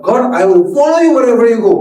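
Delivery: a hint of proud Peter promising loyalty; Peter's bold vow. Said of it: "Petrus bilang".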